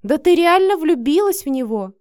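loud, high astonished question; taken aback. A narrator's reading. Marina realizes her friend is in love, stunned question. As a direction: blurt it out in disbelief. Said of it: "Марина опешила".

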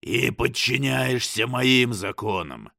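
slow, booming demon. A narrator's reading. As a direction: boom each word slowly. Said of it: "прогремел голос".

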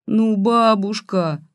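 put-on high, drawn-out girlish whine; plaintive whine. A narrator's reading. Granddaughter protesting to granny, plaintive whine. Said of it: "жалобно заныла Катька".

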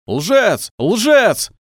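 высокие громкие выкрики по слову; скандируй, нарастая от крика к крику; скандирование толпы. A narrator's reading. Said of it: "начали скандировать сектанты". (скандировать)

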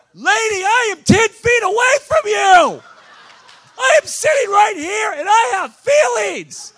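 preacher yelling at a fan who ignored him, hammed-up outrage; exasperated shout. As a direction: bellow your hurt at her, slowing down with a laugh. Exasperated outburst at pushy fan.

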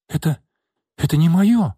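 high, loud indignant exclamation; indignant cry. Acted. Maid cries out to Nastya that it is not hers, indignant. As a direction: protest sharply, voice jumping up. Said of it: "возмущённо воскликнула девушка".

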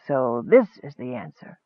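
low, soft and measured. A narrator's reading. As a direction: say it softly, like a voice in a dream. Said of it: "said a soft voice".

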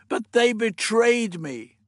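high, drawn-out lament; loud, slow anguish; despairing cry. Acted. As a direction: wail the betrayal slowly. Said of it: "cried in despair".

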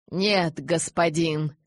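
usual speaking voice

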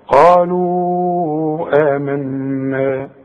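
قالوا آمنا slow, the madd held long and joined into آمنا; joined recitation. Shown as a demonstration. Teacher reciting قالوا آمنا slowly to show joining. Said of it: "إنما حوصل".

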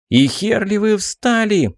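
high slow furious yell; shouting. Acted. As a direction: yell at the gawking crowd. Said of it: "крикнула на них девушка".

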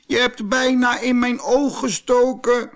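high, loud pleading little voice; little man crying out. A narrator's reading. Jack-in-the-box begging the hammer, high pained cry. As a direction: beg the hammer to stop. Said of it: "riep het mannetje".

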